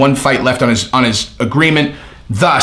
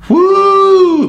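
high loud whoop of joy; excited whoop at the news; excited woo; 4 dB louder than usual. Real, not acted. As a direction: cheer out loud. Celebrating the Eagle news.

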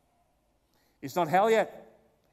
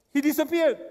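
excited; 10 semitones higher, 8 dB louder than usual. Real, not acted. Preacher dramatizing sudden vanishing.

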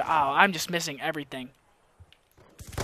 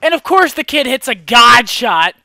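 slow, loud, higher complaint; bitter outburst; 5 semitones higher, 11 dB louder than usual. Real, not acted. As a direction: stretch it out in loud exasperation. Killed by a lucky shot.

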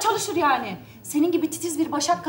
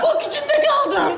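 laughing; 8 semitones higher, much slower than usual. Real, not acted. Girl finds she sat in dirt.

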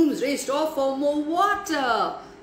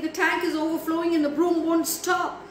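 fast, high-pitched warning; alarmed; 6 semitones higher, faster than usual. Acted. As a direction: rush out the bad news. Tabitha raises the alarm.

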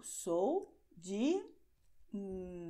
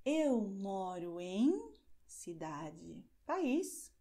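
slow and careful, a pause before each slot; teacher demonstrating the formal answer; formal model answer; much slower than usual. Shown as a demonstration. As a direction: model the formal version slowly. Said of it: "se a situação for formal".

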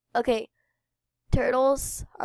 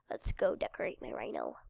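whispering; 12 semitones lower, 8 dB quieter than usual. Real, not acted. Aside to herself.

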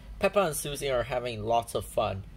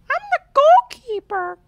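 defiant Rebecca Rabbit; 14 dB louder than usual. A narrator's reading. Rebecca insists she may hold the ball, high and loud. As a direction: insist stubbornly.

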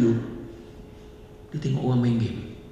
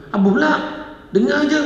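put-on protesting child; 11 semitones higher than usual.

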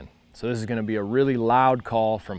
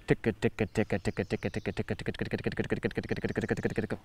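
speeding up; 6 dB quieter, much faster than usual. Shown as a demonstration. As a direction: roll ticka, ticka faster and faster. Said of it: "work your way up in speed".

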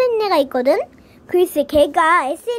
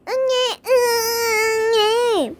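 put-on baby crying; 3 semitones higher than usual. Baby sister fussing as brother refuses, acted crying. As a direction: imitate a fussing baby's cry.

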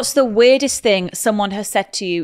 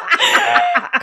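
high squealing laugh. Real, not acted. Reaction to exclusive question.

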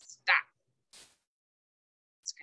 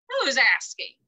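louder, curt demand; gruff challenge; 7 dB louder than usual. A narrator's reading. Goat challenging the little ogre. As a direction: challenge him. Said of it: "answered Gruff".

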